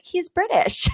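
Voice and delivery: lightly voiced, high-pitched claim; high mock-casual quip. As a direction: toss it off high and breezy. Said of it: "I'll be like".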